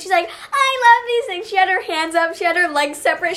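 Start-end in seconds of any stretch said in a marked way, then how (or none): 0.3-1.5 high, loud, drawn-out kiddie voice; imitating a delighted tiny child; excited two-year-old. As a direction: squeal it slowly like a thrilled toddler. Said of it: "like, two-year-old kid".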